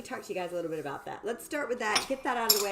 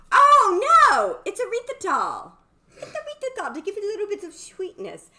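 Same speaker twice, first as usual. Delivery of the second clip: excited realisation; 11 semitones higher, 14 dB louder than usual. Pretend. Viewer corrects her, mock reply.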